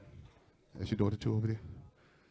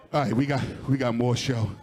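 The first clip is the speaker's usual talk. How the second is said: ordinary talk, nothing marked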